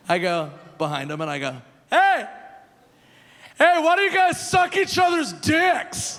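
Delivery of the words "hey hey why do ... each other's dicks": put-on high, loud, drunk shout; drunken yell. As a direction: yell it across the bar, tipsy. Drunk teller shouts at strangers.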